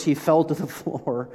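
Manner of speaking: real laugh breaking into the line; can't hold back a chuckle mid-sentence. Real, not acted. Preacher laughing despite himself at a grim verse. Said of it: "Why am I laughing?".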